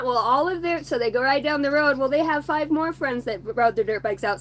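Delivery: ordinary delivery, nothing marked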